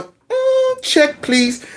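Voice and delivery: high sassy mm, finger up; leaving the choir with a sassy check please; sassy exit. Pretend. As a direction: brush them off with sass. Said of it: "throwing my finger up going".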